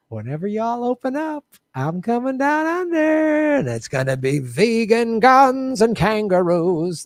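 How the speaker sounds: sing-song mock Aussie twang; bad Aussie accent. Comedian mock-sings his Australia trip in bad Aussie accent. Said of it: "I was trying to do a bad accent".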